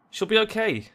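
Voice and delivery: high-pitched, uneasy reassurance; worried brave words. Acted. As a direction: insist it bravely while sounding worried sick. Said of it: "her tone tells a different story".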